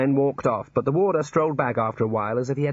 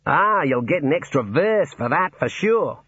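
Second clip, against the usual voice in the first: Australian warder; 5 semitones higher, 4 dB louder than usual. Acted. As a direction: play the cheery Australian warder.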